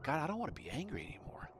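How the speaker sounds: whispered plea to God; whispered prayer. Retold or imitated. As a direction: breathe the prayer out, almost silent. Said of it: "I was like".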